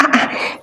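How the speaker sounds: soft disbelieving exhale; whispered ah ah of dismay; breathy unvoiced ah ah. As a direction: gasp of quiet disbelief. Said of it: "I was like".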